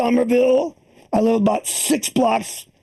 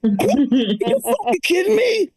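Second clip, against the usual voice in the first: incredulous; much slower than usual. Real, not acted. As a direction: stretch it out in disbelief. Comic's outraged reaction.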